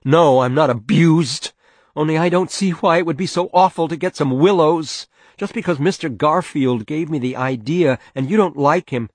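loud, high, indignant protest; voice rising. Acted. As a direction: raise your voice and argue back. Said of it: "His mother's voice rose".

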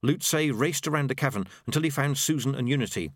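ordinary delivery

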